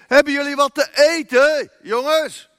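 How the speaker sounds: high, loud call from the shore. A narrator's reading. Jesus calls to the disciples in the boat, loud and high. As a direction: call across the water to the boat. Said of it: "Hij riep".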